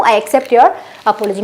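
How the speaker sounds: ordinary talk, nothing marked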